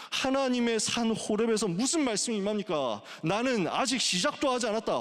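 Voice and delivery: high, emphatic proclamation; full-force preaching. Real, not acted. Preacher declaring God's word at Horeb, preaching at full force. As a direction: proclaim it like a command from God.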